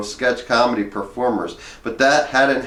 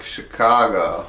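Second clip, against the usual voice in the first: Chicago said slowly, leaning on it; stress on Chicago; slower than usual. Shown as a demonstration. Slow emphatic Chicago to answer LA and New York. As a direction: land hard on Chicago. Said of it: "Not L A, not New York".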